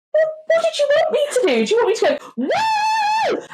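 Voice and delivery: high, loud mock-mum voice ending in a woo shout; mum's whoop. Mum driving on an A road, shouting woo. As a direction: play the mum throwing up her hands and whooping. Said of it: "she did that at the top of her lungs".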